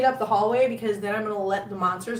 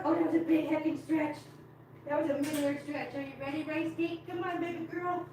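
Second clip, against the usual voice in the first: high, soft pet-talk voice; coaxing cats; 6 semitones higher, 9 dB quieter than usual. Real, not acted. Owner inviting her kitties out to play, high soft coaxing. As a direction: sweetly coax shy pets out.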